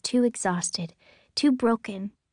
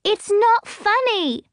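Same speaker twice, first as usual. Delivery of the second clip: put-on very high, loud, slow, shrill kid; hurt girl crying out; tearful outburst; 12 semitones higher, 7 dB louder, slower than usual. A narrator's reading. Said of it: "Emmeline cries".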